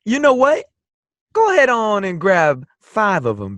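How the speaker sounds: high, lilting character voice; player's character voice. Acted. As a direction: coax the bartender with a high, rising lilt.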